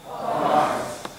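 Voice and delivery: faint unvoiced crowd reply; a half-hearted mumbled answer; weak audience answer. Real, not acted. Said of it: "I thought Scotland were more powerful than that".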